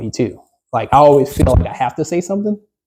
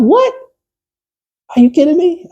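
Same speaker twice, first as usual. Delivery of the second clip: high, disbelieving outburst; stunned what, are you kidding; shocked. Retold or imitated. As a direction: leap up in disbelief. Shocked reaction in retold call.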